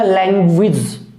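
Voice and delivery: लैंग्वेज said "lang-gwij"; corrected pronunciation. Shown as a demonstration. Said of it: "इसका pronunciation जो रहेगा".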